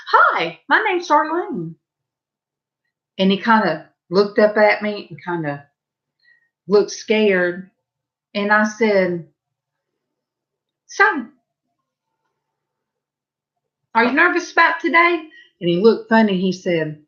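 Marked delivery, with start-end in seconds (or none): 0.1-1.8 much higher, cheerleader-bright hello; eager new-hire greeting, pitched well up; peppy introduction. Retold or imitated. Super psyched first-day self-introduction. 10.9-15.3 much higher, chirpy concern; upbeat, high-pitched check-in; cheery question. Retold or imitated. Cheerfully asking a shy newcomer.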